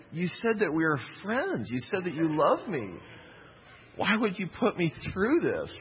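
a hint of a betrayed three-year-old pleading; hurt little kid. Hurt child voiced after injury.

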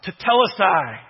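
loud ringing cry of Tetelestai; yelled out. Retold or imitated. Jesus crying out from the cross, loud triumphant shout. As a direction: call "Tetelestai!" out like a victory cry. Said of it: "That's what he yelled out".

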